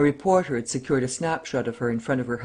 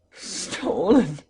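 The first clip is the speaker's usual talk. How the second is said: moaning; 5 semitones higher than usual. A narrator's reading. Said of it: "he moaned".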